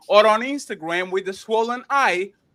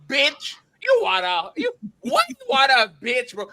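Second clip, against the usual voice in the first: mock disbelief; 6 semitones higher, 3 dB louder than usual. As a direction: react like she can't believe her man is crying. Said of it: "you would look at me like".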